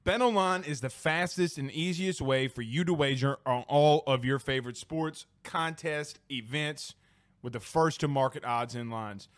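quick, soft sponsor read. Acted. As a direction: read it like a radio spot. Ad read, softer.

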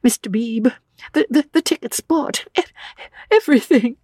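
put-on high, halting, tearful young woman; distraught, voice pitched high and catching; sobbing. A narrator's reading. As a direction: stammer it out through rising sobs. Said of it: "She fell sobbing".

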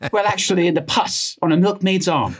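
high Received Pronunciation; RP accent. Acted. Jenner answering the troll, in high-pitched RP. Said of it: "pulling in this R P accent".

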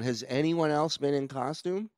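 usual speaking voice

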